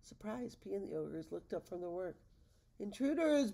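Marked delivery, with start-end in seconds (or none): 2.8-3.5 high, loud alarmed bellow. Acted. Ogre father spotting intruders at the window, bellowing. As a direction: raise the alarm at strangers. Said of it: "bellowed Tenet".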